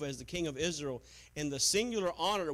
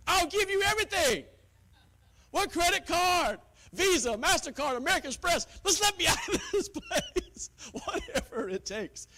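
high, loud, laughing caricature of a worn-out churchgoer; mock desperate giver; 14 semitones higher, 7 dB louder than usual. Preacher mocking a pressured churchgoer, loud and laughing. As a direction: ham up a congregant ready to give anything.

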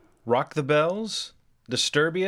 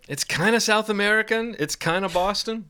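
higher-pitched mock uncertain answer; Forrest Whitaker answering; 8 semitones higher than usual. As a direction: play a flustered actor guessing at his own accent. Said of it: "what kind of accent are you doing".

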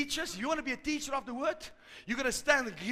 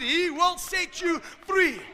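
loud, high and slow, each word weighted; raised pulpit voice, stretching the words out; emphatic preaching repeat; 7 semitones higher, 7 dB louder, slower than usual. Real, not acted. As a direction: drive it home to the congregation, drawn out.

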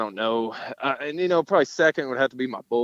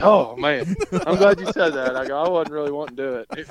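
retold relief; 6 semitones higher than usual. Rider let off the bull ride, retold relief. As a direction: let the relief burst out, easing off at the end.

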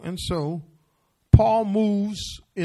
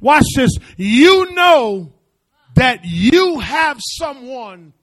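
preaching climax; 6 semitones higher, 12 dB louder than usual. Acted. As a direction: drive it home to the congregation.

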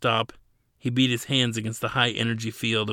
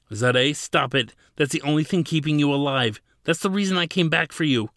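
louder, higher urgent begging; 5 semitones higher, 4 dB louder than usual. A narrator's reading. Ladin begging Zadeh not to remove her core, urgent higher plea. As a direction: plead with rising urgency. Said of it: "Ladin begged".

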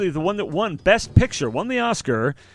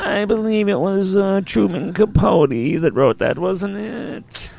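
lightly voiced Truman Capote; a hint of Capote's voice; Truman Capote impression; 3 semitones higher, slower than usual. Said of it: "doing an impression of Truman Capote".

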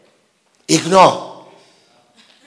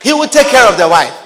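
higher, louder sermon exhortation; a pastor pounding home his point; fiery preaching; 10 semitones higher, 7 dB louder than usual. Acted. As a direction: preach it hard to the congregation. Preaching at full force.

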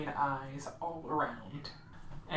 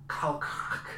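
lightly croaked name like a jaw click; jaw-resetting sound. Acted. Game master voices creature resetting her jaw, lightly croaked. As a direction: say the name as a cracking noise. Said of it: "that was the sound she made".

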